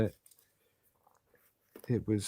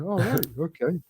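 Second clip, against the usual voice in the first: doubting neighbour; 7 semitones higher than usual. Retold or imitated. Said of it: "He's going".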